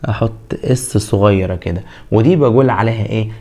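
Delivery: ordinary talk, nothing marked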